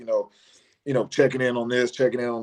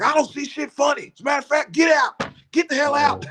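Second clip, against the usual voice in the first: high-pitched angry coach; 13 semitones higher than usual. Retold or imitated. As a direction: kick him out in a high, rising yell. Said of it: "his voice gets high".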